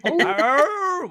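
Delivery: loud high playful howl; wolf howl. Pretend. Host echoes wolf howl.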